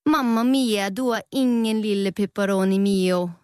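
a cheeky Italian pizza topping; Italian pepperoni, playful; mock Italian pepperoni. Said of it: "sagde en pepperoni".

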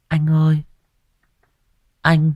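put-on high, thin child's voice begging; a small lost spirit asks the stranger for help; ghost child pleading. Ghost child begs the exorcist, high and pleading.

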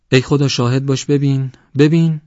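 high, loud cry to heaven; shrieked appeal. A narrator's reading. Wronged wife calling God as witness, shrieking.

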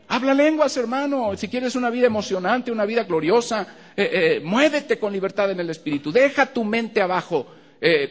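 high, loud preaching at full force; fervent exhortation. Acted. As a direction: urge the brothers with fire. Exhorts the congregation.

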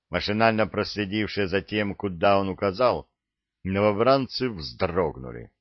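plain reading voice

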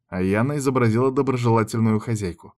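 plain reading voice

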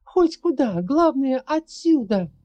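high, loud panicked urging; frantic shout. Acted. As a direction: cry it out in panic. Panicked girl urges escape.

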